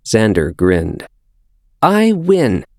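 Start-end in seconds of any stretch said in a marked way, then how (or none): 1.8-2.6 put-on high, pleased child voice; a five-year-old gloating; triumphant little boy. A narrator's reading. Xander grins, triumphant.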